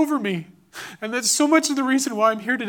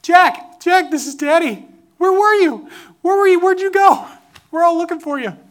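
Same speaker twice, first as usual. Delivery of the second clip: re-enacted frantic father's yell, high and loud; 8 semitones higher, 9 dB louder than usual. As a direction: call out desperately for a lost child. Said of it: "and I'm yelling".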